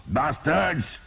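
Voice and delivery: high furious curse; enraged soldier's yell; shouting. A narrator's reading. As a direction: spit the word out loud. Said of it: "he shouted at the men".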